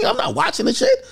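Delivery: high, laughing through the words; real laughter while refusing. Real, not acted. Laughing at his own refusal.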